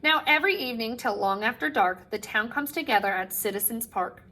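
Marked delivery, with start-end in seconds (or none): none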